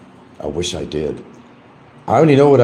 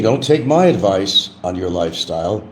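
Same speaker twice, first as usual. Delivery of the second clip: ordinary delivery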